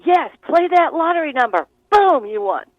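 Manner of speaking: bright burst, the thrill of a hunch; excited inner voice. Retold or imitated. Inner voice urging a lottery pick, excited. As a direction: shout it like you just hit the jackpot.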